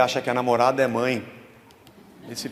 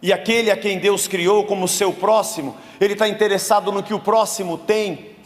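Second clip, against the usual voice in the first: preaching at full force, louder and higher; fervent preaching; 6 semitones higher, 4 dB louder than usual. Acted. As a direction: drive the sermon home with fire. Preaching to congregation. (pregação inflamada, mais alta e mais aguda)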